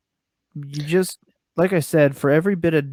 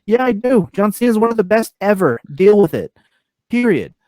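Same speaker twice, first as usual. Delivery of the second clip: high and loud, planting a flag; loud, defiant fan pride; defiant declaration; 8 semitones higher, 5 dB louder than usual. Retold or imitated. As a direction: shout down the haters. Defending John Cena to critics.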